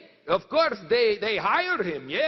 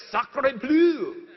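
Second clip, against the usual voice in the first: hammed-up French "Sacre bleu", higher and louder; French exclamation; 4 semitones higher, 4 dB louder than usual. Woodcutters stunned by the news, mock-French outburst. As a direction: relish the French oath. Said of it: "I like to say it".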